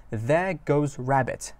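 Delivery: slow, drawn-out English phrase; careful slow read; slow lyric reading. Shown as a demonstration. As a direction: read it out slowly for learners. Teacher reads lyric slowly.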